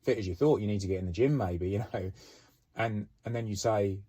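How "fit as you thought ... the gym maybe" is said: mock taunting. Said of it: "you'd be like".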